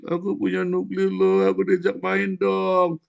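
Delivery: high, loud, slow taunting brag; sing-song loud mock boast. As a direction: brag it like a spoiled bully. Mocking Israel's boast.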